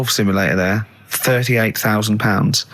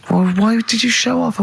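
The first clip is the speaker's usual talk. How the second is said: mock scolding wife; 11 semitones higher than usual. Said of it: "She went".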